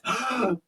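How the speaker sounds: sharp acted intake of breath; big gasp. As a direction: gulp in air before impact. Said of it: "I take in a big breath".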